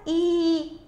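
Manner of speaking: ई held long and level; long sound. Shown as a demonstration. Teacher answering whose sound is heard, ई drawn out. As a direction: sustain ई as one long vowel. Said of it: "long sound आ रहा है ना".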